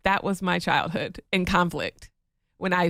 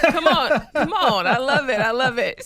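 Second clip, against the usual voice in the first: loud, high cheering; excited; 6 semitones higher, 6 dB louder than usual. Real, not acted. Wife cheering her husband's therapy praise. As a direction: hype it up.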